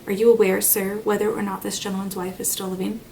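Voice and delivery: ordinary delivery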